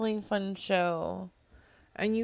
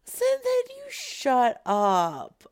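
mock scolding; 5 semitones higher, 6 dB louder, slower than usual. Streamer scolding a chat user.

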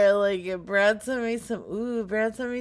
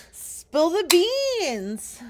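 high, slow, drawn-out prodding; playful demand; 9 semitones higher, 4 dB louder, much slower than usual. Pretend. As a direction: stretch it out, teasingly demanding the secret. Playfully demands the name's pronunciation.

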